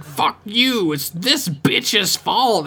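high, drawn-out imitation of an angry accuser; mock angry outburst. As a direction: stretch out the blame in a high mock yell. Acted outburst of a panicking character.

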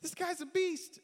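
imitating stunned people; freaking out. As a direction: gasp it like an awed onlooker. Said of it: "people freaking out like".

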